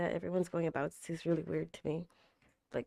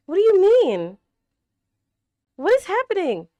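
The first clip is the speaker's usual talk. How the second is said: much higher, loud, baffled cries; shocked outburst; 13 semitones higher, 13 dB louder than usual. Real, not acted. A plot twist baffles her, loud high cries. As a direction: demand it of the screen.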